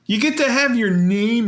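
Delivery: vintage cartoon voice. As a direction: imitate the cartoon take. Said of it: "Okay, pretty close".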